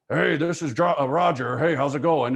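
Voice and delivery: loud, hearty phone hello; agent's phone greeting. Acted. Agent greeting a client on the phone, loud and hearty. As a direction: greet a client loudly in your own register. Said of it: "you get on the phone with a client, and you go".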